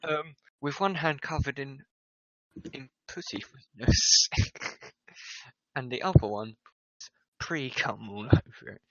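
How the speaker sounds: plain reading voice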